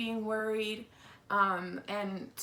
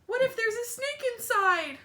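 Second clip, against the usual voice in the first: put-on much higher, anxious kid voice; worried child; 13 semitones higher, 4 dB louder than usual. Wimberly fearing the tree, high worried child voice. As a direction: fret like a small child.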